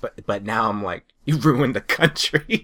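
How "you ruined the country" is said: mock accusation. Mock rant at old couple.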